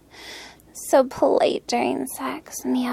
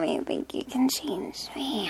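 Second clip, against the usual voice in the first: whispering; 10 dB quieter than usual. Acted. Whispered taunt.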